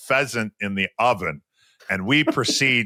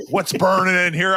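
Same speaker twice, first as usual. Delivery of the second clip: mock angry demand, pitched up and louder; retold angry firemen; 8 semitones higher, 5 dB louder than usual. Firemen confronting the player over burning pheasant, mock angry. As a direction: imitate the pissed-off firemen at the door. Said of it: "And they were fucking pissed off".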